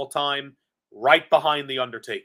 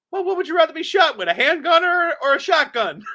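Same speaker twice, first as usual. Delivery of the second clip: much higher, louder, fast imitation of Cornette; Cornette impression; 13 semitones higher, 5 dB louder than usual. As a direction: ham up Cornette's sharp comeback. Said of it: "He looks at me and he says".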